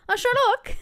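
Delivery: high sing-song West Cork Irish; West Cork accent. Shown as a demonstration. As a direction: pitch it way up in a West Cork lilt. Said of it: "go on, go on".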